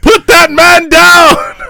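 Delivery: high loud slow command; commanding movie shout. Acted. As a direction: order it like a film hero. Quoting the film.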